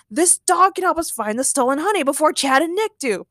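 high-pitched urgent insistence; insistent. Acted. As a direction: push the plan eagerly. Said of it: "shouted Ray".

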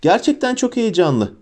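put-on high, eager boyish voice; bouncing excitement. A narrator's reading. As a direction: speak as if hopping with excitement. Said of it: "Adeta yerinde hoplayıp zıplayarak".

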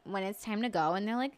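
usual speaking voice

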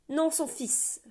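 put-on young man correcting the stranger; sharp retort. A narrator's reading. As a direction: snap back the correction. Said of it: "répliqua le jeune homme".

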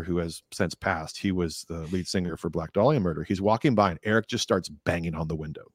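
normal talking voice

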